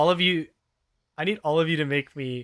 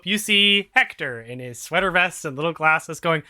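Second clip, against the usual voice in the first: ordinary delivery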